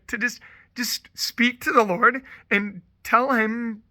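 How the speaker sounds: ordinary talk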